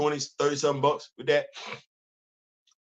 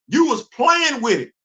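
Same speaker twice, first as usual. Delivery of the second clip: "playing" drawn out and high, with emphasis; stress on playing; 7 semitones higher, 3 dB louder than usual. Real, not acted. Vlogger recalling childhood toys to viewers, emphatic stress on "playing". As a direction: lean slowly and hard on "playing". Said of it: "Emphasis on the word playing".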